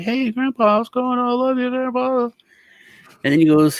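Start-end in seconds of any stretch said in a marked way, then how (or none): 0.0-2.2 high, sugary grandson voice; mock sweet grandson. Grandson greets his old grandpa, high sugary voice. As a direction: gush at grandpa like a little boy. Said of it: "I was like".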